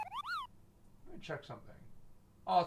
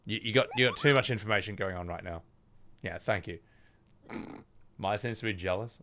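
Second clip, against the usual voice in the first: usual speaking voice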